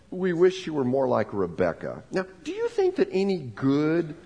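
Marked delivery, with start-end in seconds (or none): none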